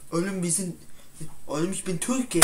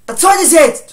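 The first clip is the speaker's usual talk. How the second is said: much higher and louder; outburst; 9 semitones higher, 16 dB louder than usual. Acted. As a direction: explode at him. Outburst in argument. (wütend gebrüllt)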